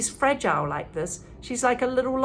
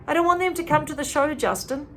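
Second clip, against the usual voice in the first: impatient; 7 semitones higher than usual. A narrator's reading. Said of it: "she interrupts impatiently".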